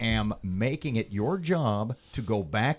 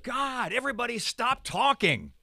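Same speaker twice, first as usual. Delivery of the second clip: high-pitched exasperated yell; 11 semitones higher than usual. Real, not acted. Host scolds chattering studio guests, yelling high. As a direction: yell high at everyone to stop talking. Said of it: "Don't yell at our guests".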